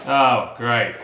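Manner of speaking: disappointed. Real, not acted. Husband hearing the box is from the Netherlands, disappointed. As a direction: let down, unimpressed reaction. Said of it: "You sound disappointed".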